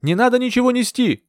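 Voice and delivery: high, insistent call over water; urgent shout. A narrator's reading. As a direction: call back to stop him. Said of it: "крикнула я".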